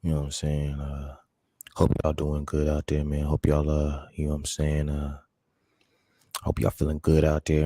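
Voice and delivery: deep smooth radio-lover voice sending good wishes. Pretend.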